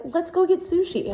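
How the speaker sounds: mock high husband voice; husband's line, high. Husband voiced in retold story.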